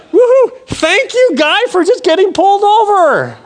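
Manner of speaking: high, loud, hammed-up whoop of thanks; gleeful celebration. Driver thanking the speeder who saved him, jubilant. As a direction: cheer it out in comic triumph.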